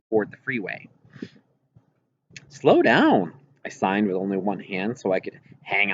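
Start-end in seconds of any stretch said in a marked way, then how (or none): 2.5-3.3 a higher, louder frightened plea; urgent plea. Acted. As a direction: beg her to slow down, gripping on. Scared girl begs speeding grandma.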